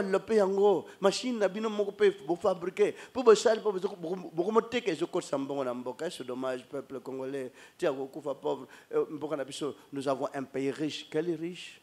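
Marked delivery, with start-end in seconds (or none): none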